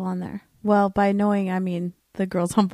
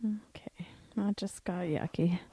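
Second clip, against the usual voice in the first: whispering; 12 dB quieter than usual. Real, not acted. Embarrassed aside after crude joke.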